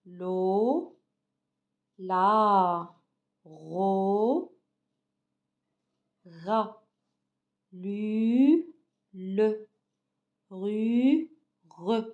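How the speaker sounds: lo, la, ro, ra, lu, le, ru, re each said alone with pauses between; teacher drills syllables for the class to repeat; sounding out syllables. Shown as a demonstration. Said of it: "Alors, avec moi".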